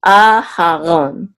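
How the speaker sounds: Aharon very slowly, first A held; secondary accent on A. Shown as a demonstration. Teacher repeating the correct form, Aharon slow with long A. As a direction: stretch the A, then a full ron in Aharon. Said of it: "it's Aharon, okay?".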